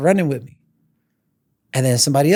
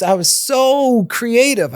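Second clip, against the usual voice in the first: normal talking voice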